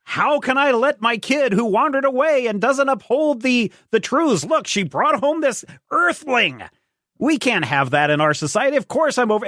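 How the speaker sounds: high, shrill-pitched crone ranting; old hag. Retold or imitated. Hag scolding Dream Girl about her daughter, pitched very high. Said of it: "And the old hag is".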